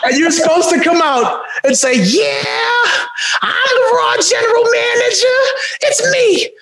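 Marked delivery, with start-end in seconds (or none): 2.0-6.5 high, drawn-out Jersey heel shout; big wrestling promo, pitched way up; big jerk from Jersey. Acted. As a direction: crow it like a hammed-up Jersey villain. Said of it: "this big jerk from Jersey".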